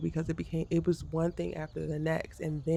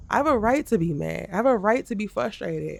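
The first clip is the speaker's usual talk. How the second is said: higher and louder, standing her ground; indignant declaration; 5 semitones higher, 5 dB louder than usual. Real, not acted. Telling viewers she has a right to be mad, indignant. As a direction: claim it, heated.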